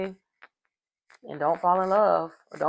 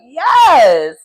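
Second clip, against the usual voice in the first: excited yes; 5 semitones higher, 12 dB louder than usual. Retold or imitated. Retelling his excited reaction.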